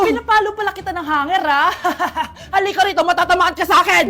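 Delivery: loud motherly threat; angry threat. Acted. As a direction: threaten the child at full volume.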